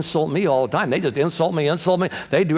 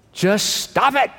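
lightly voiced deadpan counsel; a hint of a dry comic's "just stop it"; Bob Newhart; 3 semitones higher, slower than usual. Preacher quoting a comedian's advice, mild deadpan impression. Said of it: "I love Bob Newhart's counsel".

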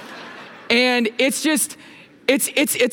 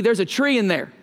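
quicker Okie twang. Shown as a demonstration. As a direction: toss off "There's a tree in there" quickly with an Okie accent. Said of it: "you could say a little bit quicker, a little more oaky".

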